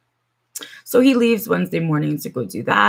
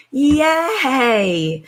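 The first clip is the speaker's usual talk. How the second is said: high, mock-cheerful yay; sarcastic yay; 8 semitones higher than usual. Real, not acted. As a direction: fake celebration, dripping sarcasm. Sarcastic cheer at broken finger.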